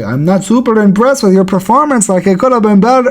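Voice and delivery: softening the blow, imitated; impression of a fighter sparing someone's feelings. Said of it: "trying not to hurt his feelings".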